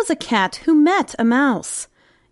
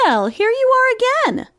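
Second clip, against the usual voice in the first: higher, lilting mouse welcome; mouse's greeting; 10 semitones higher than usual. Acted. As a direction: greet the returning cat in a high little voice. Mouse greets the returning cat.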